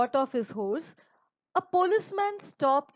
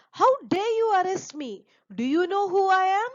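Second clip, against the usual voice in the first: high, loud indignant demand; angry outburst; 8 semitones higher, 5 dB louder than usual. Acted. Arrested groom shouting at the policeman, angry. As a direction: rich man furious at being arrested. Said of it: "So Kantilal got very angry".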